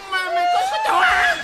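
very high, stammering shout; stammer, then shriek in disbelief; shocked shriek. Acted. Hearing Út is pregnant, shocked shriek.

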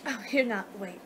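normal talking voice

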